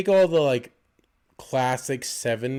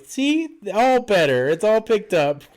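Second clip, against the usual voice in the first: high, chirpy and a little louder; sing-song nanny voice; 7 semitones higher, 5 dB louder than usual. Retold or imitated. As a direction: coo it like a fake-cheerful nanny. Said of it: "she's like".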